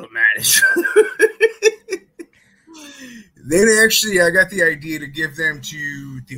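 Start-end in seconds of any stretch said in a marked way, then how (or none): 0.0-0.8 laughing through the words, a bit louder; can't get it out without cracking up; giggling while telling the story. Real, not acted. Throwing shopping carts at each other. 0.9-2.2 big real laugh; loud laugh. Real, not acted. Laughs at his own story.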